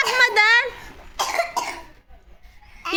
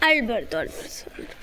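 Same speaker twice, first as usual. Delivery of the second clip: soothing; 9 semitones lower, 7 dB quieter than usual. Real, not acted. Aunt soothing a crying child.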